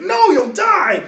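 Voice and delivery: high, loud, slow warning shout; alarmed shout. Acted. Governor holding the sergeant back, loud alarmed shout. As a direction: hold him back with a yell. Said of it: "He's like".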